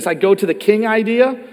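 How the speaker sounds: normal talking voice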